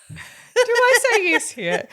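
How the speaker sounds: high laughing question; giggling disbelief. Real, not acted. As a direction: ask it through real laughter. Laughing at the doctor mix-up.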